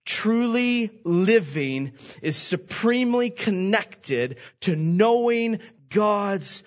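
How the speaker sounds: full-force preaching. Acted. Pastor drives the point home to the congregation, preaching hard. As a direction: preach it with fire and urgency.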